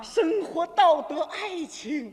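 grandiose, drawn-out poetry recital; inspired poet. Acted. A brother's foot bath sparks a poem, grandiose declaiming. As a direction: declaim each phrase like a fevered poet. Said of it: "激发了我的灵感".

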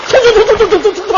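high, loud sputtering outburst; exasperated sputter. Real, not acted. Storyteller sputters in outrage at the partner's ad. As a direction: sputter in disbelief.

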